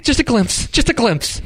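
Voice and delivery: high-pitched, mock-desperate pleading; desperate begging. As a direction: beg like an addict craving a hit. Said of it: "like a crackhead needing a hit".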